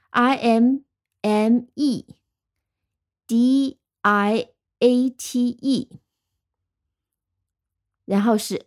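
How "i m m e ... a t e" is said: slow, letter by letter, with pauses between groups; spelling out. Shown as a demonstration. Teacher spelling the word for learners, slowly letter by letter. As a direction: spell it slowly in syllable chunks. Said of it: "再拼一遍".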